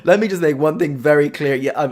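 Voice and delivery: normal talking voice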